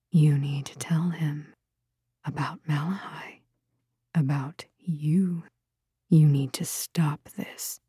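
low, hushed, halting warning; soft, insistent voice in the head; inner voice whisper. A narrator's reading. Her own thoughts warning her to stop, whispered.

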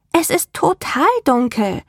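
put-on high, bright-eyed little girl. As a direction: speak as an eager young girl. Child Rebecca voiced by narrator.

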